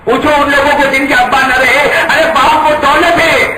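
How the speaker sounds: a preacher pressing the point harder; intensified appeal. Real, not acted. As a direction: raise it up on the repeat. Repeated, raised.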